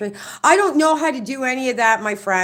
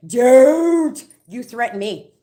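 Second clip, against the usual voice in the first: retold warning; 6 dB louder, slower than usual. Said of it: "I went up one motherfucking side of him".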